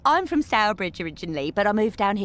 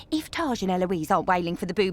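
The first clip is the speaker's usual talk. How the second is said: ordinary delivery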